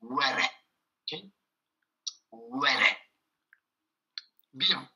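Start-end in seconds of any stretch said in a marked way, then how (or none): 0.0-0.4 Were said alone with a Spanish R. Shown as a demonstration. Teacher modelling the Spanish R in Were for French speakers, pronunciation demo. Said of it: "c'est un R espagnol". 2.3-2.9 Were said slowly alone with a Spanish R. Shown as a demonstration. Teacher modelling Spanish R in Were for learners, pronunciation demo. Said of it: "c'est un R espagnol".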